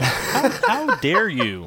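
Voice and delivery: mock outrage. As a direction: drag it out in theatrical outrage. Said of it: "worried that you guys will shout at me".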